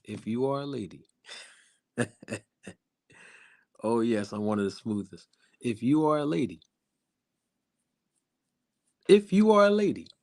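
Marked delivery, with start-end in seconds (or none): 0.0-1.1 low, soft and smooth crooner-style speech. Pretend. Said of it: "get my [name] voice on". 3.8-6.6 playfully smooth, low and soft. Pretend. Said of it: "get my [name] voice on".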